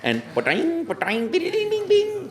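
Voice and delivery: high bouncing "ba-ding" bleeps; game sound effects. Pretend. Voice actor imitating Mario game bleeps, very high. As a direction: chirp them out like coins dropping.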